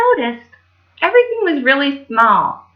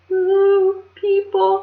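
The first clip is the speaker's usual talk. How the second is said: high, shy little coo; shy, high dwarf voice; timid high coo; Bashful voice; 10 semitones higher, much slower than usual. Acted. Bashful's line, named after.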